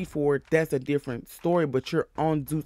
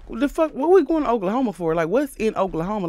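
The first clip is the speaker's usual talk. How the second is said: high, fast disbelieving whine; baffled outburst; 6 semitones higher, 4 dB louder than usual. Retold or imitated. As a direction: act out the girls' shocked complaint. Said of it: "And the girls is like".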